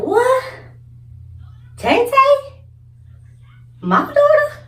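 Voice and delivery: very high, loud squealing disbelief; shocked mama. Retold or imitated. Mama hearing her daughter punched a boy, squealing high disbelief. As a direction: shriek it out in disbelief. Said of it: "my mama was like".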